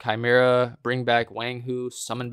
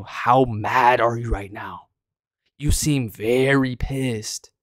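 slow mocking taunt; slower than usual. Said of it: "I was exaggerating a little bit for a video".

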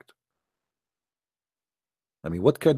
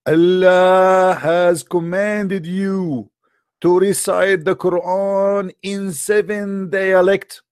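slow, higher, grand pronouncement; solemn angel's message; 5 semitones higher, 3 dB louder, much slower than usual. Retold or imitated. Angel Jibreel delivering a command to Muhammad, slow and grand. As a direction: intone it slowly like a heavenly decree. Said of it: "he said to Muhammad".

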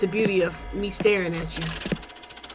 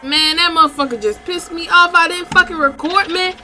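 louder, higher angry rant; real anger; 6 semitones higher, 4 dB louder than usual. Real, not acted. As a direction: blow up at the missed recording. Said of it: "I'm so mad".

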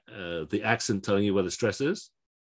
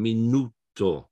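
"minuto" slowly split, long stressed NU; next-to-last syllable stress; slower than usual. Shown as a demonstration. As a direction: spell out "minuto" by sound, mi-NUU-to. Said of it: "The stress is on the".

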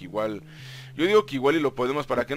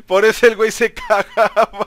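laughing; 5 semitones higher, 6 dB louder than usual. Real, not acted. Laughing at his own joke.

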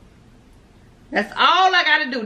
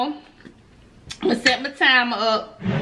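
ordinary talk, nothing marked

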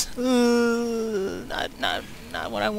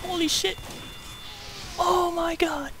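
stunned; 9 semitones higher, 5 dB quieter than usual. Real, not acted. Disbelief after risky kill.

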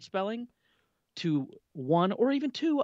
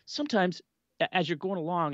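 normal talking voice

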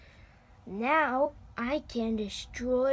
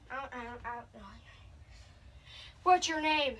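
usual speaking voice

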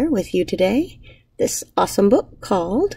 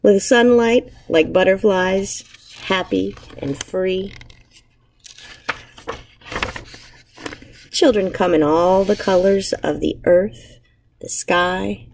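put-on reading to children. Teacher reading a picture book aloud to her class, gentle storytelling. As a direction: savour each image for young listeners.

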